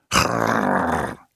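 long rolled growl of a dog. A narrator's reading. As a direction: growl like a dog refusing to budge. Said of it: "zei Mars". (grommende hond)

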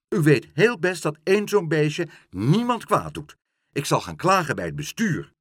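loud, heated argument; hot-tempered. A narrator's reading. Meneer Pen threatening the porter with a complaint, hot-tempered. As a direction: argue crossly and threaten action. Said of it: "riep meneer Pen driftig".

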